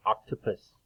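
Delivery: octopus with an open ah at the start; short o in octopus. Shown as a demonstration. Teacher modelling the short o sound for children, open ah. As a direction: stretch the ah in octopus. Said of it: "when we say O, we say O".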